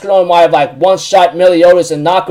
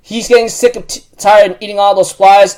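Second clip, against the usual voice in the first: normal talking voice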